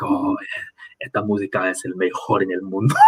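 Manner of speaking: softly voiced proud Valencian taxi driver, in Spanish; a lightly imitated boast about local music; hint of a proud local bragging. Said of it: "so proud to be a Valencian".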